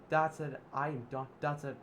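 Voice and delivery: mother Alice scolding Zip and Oliver; fed-up anger. Acted. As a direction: lay down the law, done with them. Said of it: "Alice said".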